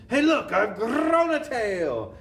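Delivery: high, loud, drawn-out astonishment; hammed-up high-pitched yell; girl's yell. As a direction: cry out like a startled schoolgirl. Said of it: "She yells".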